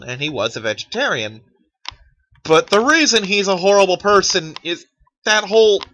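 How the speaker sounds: ordinary talk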